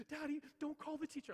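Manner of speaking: put-on very high, loud child's voice; mock panicked squeal from a little girl; imitating pleading daughter. Daughter begs dad not to phone teacher, high mock pleading.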